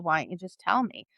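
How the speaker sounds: ordinary talk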